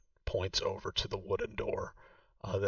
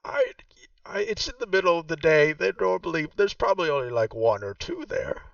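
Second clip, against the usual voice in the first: game master voicing Vaku, noticeably higher; Vaku's voice; 8 semitones higher, 8 dB louder than usual. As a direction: play the proud host showing her old home. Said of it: "She says".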